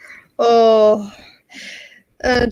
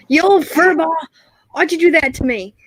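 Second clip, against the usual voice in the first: Han complaining to Chewie; 5 semitones higher than usual. Acted. Han complains after Chewie bops him, mock hurt. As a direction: whine like a knocked-over pilot. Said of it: "That's a very good Chewie impression".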